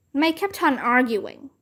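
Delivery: usual speaking voice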